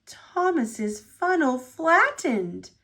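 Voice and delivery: usual speaking voice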